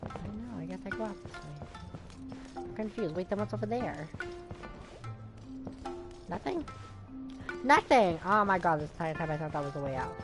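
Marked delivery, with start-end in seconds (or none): none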